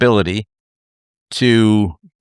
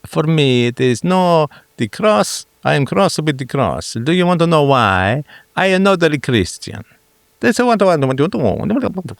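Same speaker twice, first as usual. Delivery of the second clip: high, bouncy cartoon Italian accent; cheesy Mario Italian, dissolving into babble; Mario-style Italian accent; 6 semitones higher than usual. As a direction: ham up a singsong Italian accent. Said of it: "That just dissolved into Mario babble".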